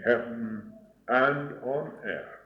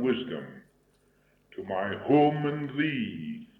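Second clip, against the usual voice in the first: swelling, higher devotional prayer voice; recited prayer; 3 semitones higher, 4 dB louder than usual. Acted. Preacher reciting a benediction before the congregation, devotional. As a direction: lift each line toward heaven.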